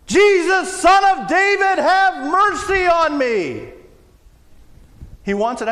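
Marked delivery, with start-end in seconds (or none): 0.1-3.7 calling out at full voice, high and loud; a blind beggar crying out over the crowd; desperate shout. Acted. Beggar shouting to Jesus over the crowd, loud desperate cry. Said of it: "And he shouts".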